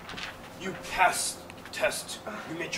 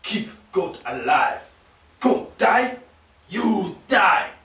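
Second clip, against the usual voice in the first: broken English guard voice; 2 semitones higher than usual. Acted.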